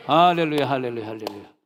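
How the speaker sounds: preacher's joyful hallelujah shout; loud hallelujah cry. Real, not acted. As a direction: shout it out to the congregation.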